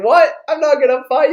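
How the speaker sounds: high, loud, startled refusal; incredulous protest. Retold or imitated. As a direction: play the baffled son backing out. Son protests dad's challenge.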